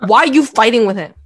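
loud mock-mom accusation; imitated scolding. Imitating mom blaming her for fighting, loud scolding. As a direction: demand it loudly, as a mother would.

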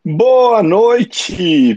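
boa noite alto e agudo; saudação animada. Real, not acted. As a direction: cumprimente o público com entusiasmo. (saudação empolgada)

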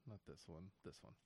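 quiet mutter while searching; muttered under breath. Real, not acted. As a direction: mumble it to yourself.

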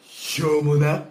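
a loud, slow scoff at cheap sake; scornful sneer. Acted. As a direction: spit it out with contempt.

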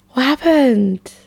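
slow, breathy stage whisper; hushed, slow whisper. Acted. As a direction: whisper it like a secret. Repeats question, then whispers it.

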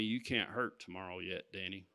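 soft, low and gentle, a quiet inner voice; still small voice. Retold or imitated. Preacher retelling God's quiet word to him, soft and low. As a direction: hush it like a voice inside your head. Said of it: "that still small voice".

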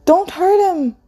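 put-on high-pitched frightened toddler; screeching toddler. A narrator's reading. Toddler clinging to Orlu as Sunny approached, high screech. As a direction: protest in a small child's high voice. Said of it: "one of the toddlers screeched".

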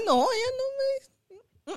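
llorosa, aguda y lenta; a punto de llorar al recordarlo; voz quebrada. Real, not acted. Recuerdo doloroso, voz quebrada. (con la voz entrecortada por el llanto)

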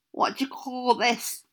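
talking through sticky cake; mouth full of sticky cake. Acted. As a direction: teeth stuck with cake. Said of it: "he said as it stuck to his teeth".

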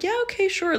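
eager yes. Retold or imitated. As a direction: say yes up high, keen to go. Eagerly agreeing to date.

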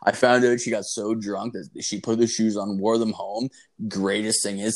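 usual speaking voice

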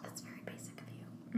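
whispered playful dig; teasing whisper. Real, not acted. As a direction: tease her in a whisper. Teasing remark to friend.

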